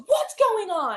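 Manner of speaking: a retold sharp demand; demanding aunt. Aunt barging in on the scolding, sharp and loud. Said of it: "she comes in, she goes".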